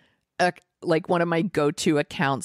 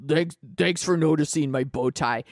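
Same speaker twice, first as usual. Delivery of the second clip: Doug the cat. Retold or imitated. Showing how one cat named Doug talks, dopey cat voice. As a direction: talk like a dim little cat character. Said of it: "he just talks like this".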